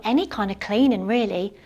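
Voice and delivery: ordinary talk, nothing marked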